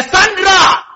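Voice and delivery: high-pitched mock call from heaven; acted summons, pitched well up. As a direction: call out the name sharply, as a sudden voice from nowhere. Imagined audible voice calling out a name.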